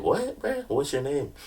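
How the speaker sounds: high incredulous what bruh; mock disbelief. Girl teasing him by asking his name again. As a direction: act like you can't believe it. Girl pretending she didn't hear.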